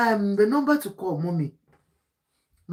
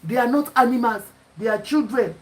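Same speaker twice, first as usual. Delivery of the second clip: normal talking voice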